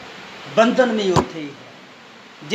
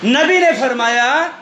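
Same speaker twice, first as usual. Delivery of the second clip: high, ringing shouts in measured phrases; full-force preaching; 6 semitones higher than usual. Real, not acted. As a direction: proclaim it to the whole congregation, pitch raised. (ਪੂਰੇ ਜੋਸ਼ ਨਾਲ ਉੱਚੀ ਸੁਰ ਵਿੱਚ ਪ੍ਰਚਾਰ)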